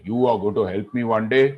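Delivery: lightly voiced deep lion, incredulous; baritone lion. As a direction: put on a touch of big-lion baritone. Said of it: "I was going in a ... Baritone voice".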